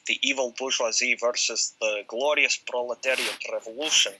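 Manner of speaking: lightly put-on Russian accent; grand Soviet-style Russian accent. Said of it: "bad Russian accent".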